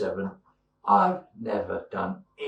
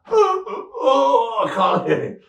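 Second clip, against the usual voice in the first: much higher, louder mock-anguished cry under strain; 12 semitones higher, 7 dB louder, much slower than usual. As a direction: wail like the weight is too much. Trying to lift weights.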